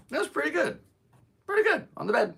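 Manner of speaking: very high-pitched pet talk; praising a dog. Real, not acted.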